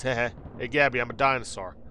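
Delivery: usual speaking voice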